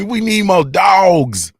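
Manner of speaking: rally the crowd with the slogan; hyped shout. Acted.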